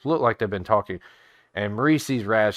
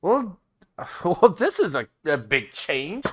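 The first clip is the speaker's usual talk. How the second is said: higher, laughing, flustered; flustered surprise; 8 semitones higher, 3 dB louder than usual. Acted. Raz reacting to the party's news, flustered and higher. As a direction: stammer in amazement.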